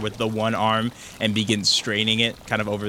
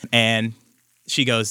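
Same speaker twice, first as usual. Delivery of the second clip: ordinary delivery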